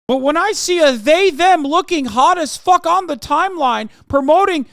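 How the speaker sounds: high, loud, whiny mock-tearful voice; teary Peterson parody. Streamer parodying Jordan Peterson tearfully ranting about themperor. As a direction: ham him up as on the verge of tears, shouting. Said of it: "Always on the verge of tears, of course".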